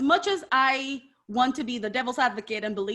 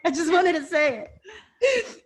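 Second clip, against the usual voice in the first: drawn-out, laughing, higher-pitched insistence; laughing protest; 5 semitones higher, slower than usual. Real, not acted. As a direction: slowly plead through laughter. Laughing, defending her joke question.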